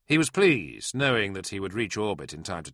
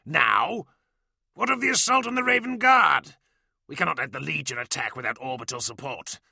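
angry protest; 7 semitones higher than usual. Acted. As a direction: demand answers, outraged. Dellarax angrily protests orders.

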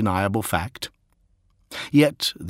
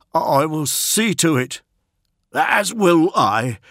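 raised, solemn vow; devoted courtier; 8 semitones higher, 4 dB louder, slower than usual. Acted. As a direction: pledge it to the prince.

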